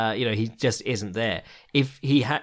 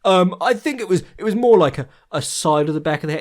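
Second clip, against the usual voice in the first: higher, louder mock voice of pedantic critics; mocking Twitter critics; 6 semitones higher, 5 dB louder than usual. Mocking Twitter nitpickers about the elbow, higher and louder. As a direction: ham up the nitpicking fans. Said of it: "some people on Twitter were still like".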